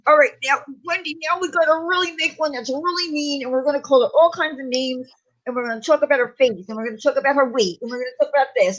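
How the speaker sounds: hammed-up impression of the rival scheming nasty insults; mocking rival's rant. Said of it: "where I had to go".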